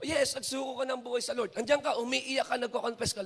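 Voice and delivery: ordinary talk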